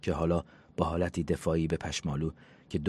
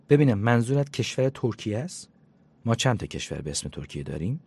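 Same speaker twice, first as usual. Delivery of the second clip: higher, louder sarcastic question; sarcastic disbelief; 5 semitones higher, 4 dB louder than usual. Acted. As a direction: needle her with mock disbelief. Jane mocks Sepanda's answer on phone.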